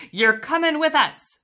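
put-on pirate captain claiming a new boy. A narrator's reading. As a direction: lay down the law as the captain. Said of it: "Braidbeard told me".

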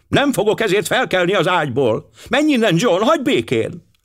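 high-pitched, irritated dismissal; snapping wife. A narrator's reading. Wife driving her husband away from her cabin door, snappish. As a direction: send him away shrewishly, pitched high. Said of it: "csattant fel Mrs. Clapperton".

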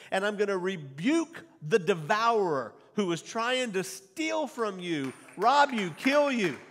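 loud, high preacher, building to rob you, kill you, destroy you; preaching at full force. Acted. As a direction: thunder God's promise over the congregation. Preacher voicing God's promise.

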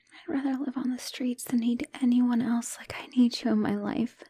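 soft unvoiced whisper of devotion; whispered declaration. Girlfriend promising her partner she'll stay, whispering. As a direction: breathe every word to him, barely audible.

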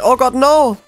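sudden high frightened cry; scared shriek. Real, not acted. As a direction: yelp in panic as he appears. Jump scare from the killer.

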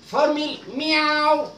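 formal meow said very slowly, drawn out; slow teaching take; slow demo. Shown as a demonstration. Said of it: "teaching the word formal meow".

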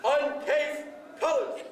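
sharp, drawn-out military command calls; drill commands. Acted. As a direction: call each command crisply to the squad.

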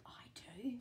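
confiding whisper. Acted. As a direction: let them in on your secret. Confiding to children.

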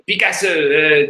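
Picasso said French-style as pikaso, stress on the last syllable; a playful French take on Picasso; French pronunciation. Retold or imitated. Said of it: "say it in French".

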